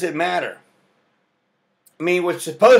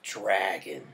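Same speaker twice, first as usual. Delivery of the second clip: deep gruff; 8 semitones lower, 9 dB quieter than usual. Retold or imitated. Giving name on form.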